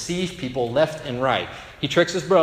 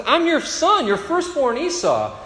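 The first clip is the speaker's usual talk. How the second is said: much higher, louder insistence; indignant insistence; 13 semitones higher, 4 dB louder than usual. Retold or imitated. Esau insists to his father, high and indignant. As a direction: insist on who you are.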